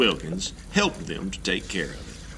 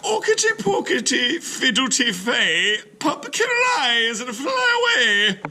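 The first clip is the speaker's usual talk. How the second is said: very high, loud cackling witch chant; witch's spell; 5 dB louder than usual. Acted. The witch chants a spell at Klug's window, high and loud. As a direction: cast it like a gleeful witch. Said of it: "She cried".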